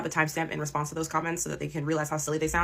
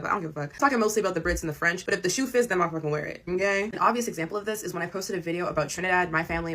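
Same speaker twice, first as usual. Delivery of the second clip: ordinary talk, nothing marked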